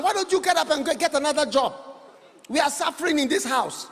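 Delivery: mocking child. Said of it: "criticize your father and say".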